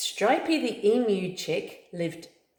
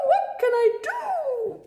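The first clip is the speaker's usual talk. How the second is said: very high, slow, falling in despair; slower despairing cry; 3 dB louder, slower than usual. Acted. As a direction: repeat it drawn out and helpless. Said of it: "screeched Fluster".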